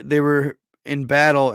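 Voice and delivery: normal talking voice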